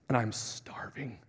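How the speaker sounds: slow, breathless whisper; whispered confession. Real, not acted. Preacher admits hunger early in his fast, whispering. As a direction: drop to a whisper, drawing it out.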